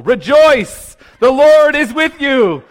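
loud, high angelic announcement, hammed up; booming mock proclamation. Preacher imagining greeting the doctor in an elevator, comic booming proclamation. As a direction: blurt it out like a herald angel.

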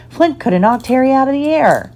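ordinary delivery

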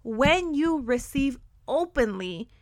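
slow, deliberate restart; slow reframe. Real, not acted. Host reframing for listeners, slow and deliberate. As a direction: say it again slowly with weight. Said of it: "let me go ahead and reframe that".

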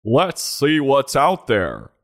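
slow, grand reading with the weight on "out"; dramatic quote reading. Acted. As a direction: read the motto like a movie trailer.

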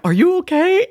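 high, loud mimicked concern; mock concern. Friends to pigeon-covered storyteller, mock concern.

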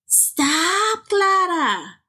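high, sharp big-sister yell; mock older sister shout. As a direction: snap at the little sister. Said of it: "Rosa yelled".

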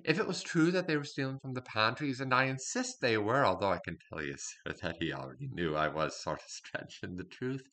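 sly servant telling his tale in a put-on accent; scheming servant character. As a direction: play the cunning kitchen spy, confiding to the sir. In-character monologue as servant spy.

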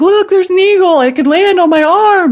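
high, loud, gushing retelling of an eager husband; thrilled mock excitement. Husband pointing out an eagle, imitated high and excited.

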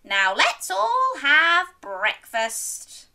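high, loud, slow announcement; jolly granny. Acted. Granny inviting everyone to breakfast, jolly and loud. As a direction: draw it out merrily. Granny happily ends the adventure.

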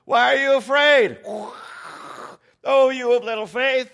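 full-force high yell; shouting over a storm. Retold or imitated. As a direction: bellow it over crashing waves. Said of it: "It's crashing and booming. He's going".

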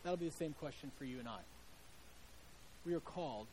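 hushed heartfelt preaching; soft preaching appeal. Acted. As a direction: draw them in gently. Quiet earnest appeal to hearers.

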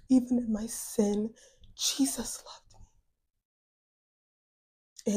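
whispered, slowed to barely breathing the words; hushed confession. Real, not acted. Telling listeners Jesus loved her in her sin, in a whisper. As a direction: drop to a near whisper, overcome. Emotional testimony climax.

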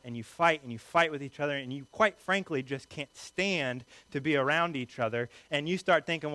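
ordinary talk

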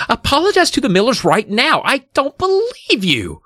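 very high-pitched scolding; acted mother at wit's end; demanding mother. A narrator's reading. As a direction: order an apology in an outraged high voice. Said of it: "Missus Powell demanded".

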